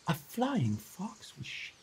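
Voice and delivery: usual speaking voice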